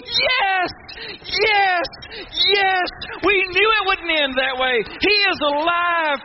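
very high, exultant shout; jubilant cheering. Acted. All the angels of heaven at the resurrection, jubilant. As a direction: cry out in victory, stretching the last words.

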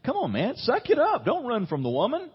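hammed-up scolding bystander; mock scolding reader. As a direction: bark it like an impatient buddy.

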